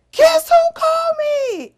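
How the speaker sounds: very high, loud, bursting with news; excited shout. Retold or imitated. Wife greeting husband at the top of the stairs, excited shout. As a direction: squeal the question out at full excitement.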